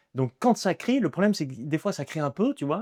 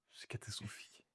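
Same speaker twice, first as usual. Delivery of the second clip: barely audible whispered confession; dismayed whisper; 3 semitones lower, 23 dB quieter than usual. Real, not acted. Confesses having nothing for his show.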